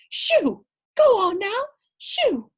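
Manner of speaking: high loud shoo. Acted. Lady shoos the wet dog away, loud and high. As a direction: chase the dog off, shouting.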